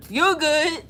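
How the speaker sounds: playful refusal. Real, not acted. Jokingly refusing to do accent.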